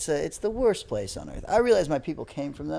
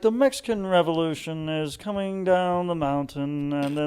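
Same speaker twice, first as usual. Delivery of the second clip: exaggerated pompous British announcer accent; 3 semitones higher, 4 dB louder than usual. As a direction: narrate like a self-important Brit, badly imitated. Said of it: "That's your British accent".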